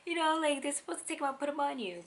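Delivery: high, exasperated you-know; exasperated aside. Real, not acted. New mother to listener, high and exasperated. Complaining they took baby away.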